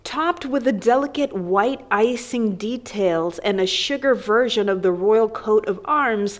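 usual speaking voice

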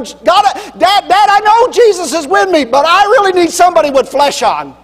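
put-on a frantic kid who needs his dad; boy hollers down to his father, desperate; desperate child hollering.

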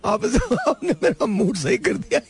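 high laughing through the words. Real, not acted. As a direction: crack up while talking. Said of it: "मैं मर जाऊंगा।".

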